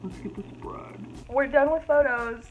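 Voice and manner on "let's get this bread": soft whisper, lower. Real, not acted. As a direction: whisper it jokingly. Whispered joke.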